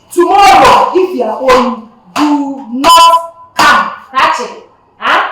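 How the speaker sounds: loud, stern warning to the class; teacher loudly warns pupils who owe fees not to come. Acted. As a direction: bark the threat at the pupils.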